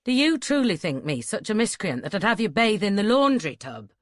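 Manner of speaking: loud, testy indignation. A narrator's reading. Duncan scolding the bather in the laundry tub, testy and loud. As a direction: push it out loud, affronted, dropping on the last word. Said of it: "He was in one of his testy moods".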